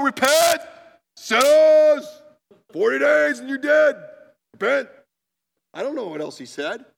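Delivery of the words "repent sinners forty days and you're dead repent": loud, much higher shouted warning; angry preaching. Retold or imitated. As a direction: bellow at the sinners like a furious prophet. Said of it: "he's saying it pretty angrily".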